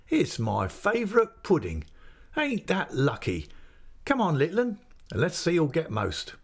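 put-on a friendly innkeeper's man coaxing the boy; chatty waiter. A narrator's reading. Waiter at the inn teasing the boy about the ale, lightly voiced.

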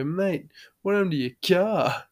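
acted-out astonished mate; retold surprise. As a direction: ask it in mock disbelief, pitch jumping up. Retelling his surprise at mate's car.

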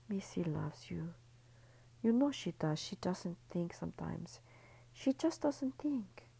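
calm her down gently; lightly voiced tender man comforting her; soothing lover. A narrator's reading. Antonio reassuring Ellie.

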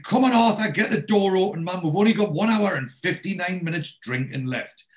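hammed-up bellow to get the door opened; mock shout. Oz bangs at the closed pub door.

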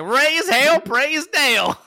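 high, loud whooping battle cry; rowdy hollering. Acted. Imagining the actor rallying the courtroom, loud high-pitched holler. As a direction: holler it like a rowdy Southern race fan.

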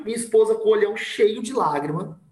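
normal talking voice

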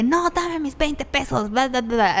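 high, slow mocking whine of a sore loser; whiny coworker. Mocking the coworker begging for twenty pesos, high whine. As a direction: mimic him whining for his money. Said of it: "Y él así de que".

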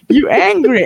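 high mocking jab on angry; high taunt. Teasing the demon, high mock jab. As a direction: needle him high with angry. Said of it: "And I was like".

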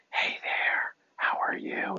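breathy unvoiced whisper. Shown as a demonstration. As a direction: whisper it softly, like a secret. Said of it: "it doesn't have to be like".

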